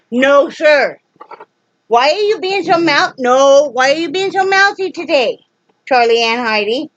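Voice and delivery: why so mouthy, said up high and offended; high-pitched scolding. Real, not acted. Owner scolding Charlie and Heidi for barking back, high and indignant.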